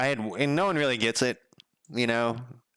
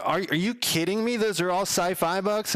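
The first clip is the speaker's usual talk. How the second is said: amazed disbelief; 9 semitones higher than usual. Retold or imitated. Delivery guy amazed at book collection.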